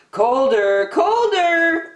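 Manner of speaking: high, teasing singsong; giggly teasing. A narrator's reading. Ariel teasing Flounder during the treasure hunt. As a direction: tease him as he looks the wrong way. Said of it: "Ariel said, giggling".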